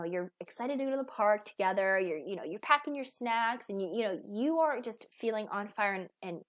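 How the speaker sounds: ordinary talk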